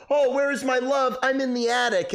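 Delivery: high, hammed-up dreamy lovesick girl; mocking Anne Frank. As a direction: parody a swooning movie heroine. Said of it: "cutting back and forth to her going".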